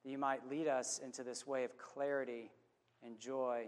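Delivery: low, unhurried prayer voice; closing prayer. Real, not acted. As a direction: pray over the congregation, slowing down. Pastor leads closing prayer.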